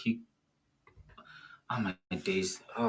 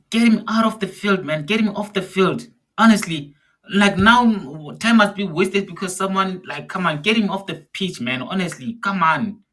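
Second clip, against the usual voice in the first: high, loud exasperated shouts at the screen; frustrated yelling; 8 semitones higher, 6 dB louder than usual. Real, not acted. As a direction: yell at the TV to get him off. Fan yelling at player on TV.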